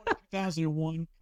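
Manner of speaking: high, incredulous repeat of the year; surprised. Real, not acted. Host hearing his young co-host's birth year, stunned and high-pitched. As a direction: echo it back in disbelief.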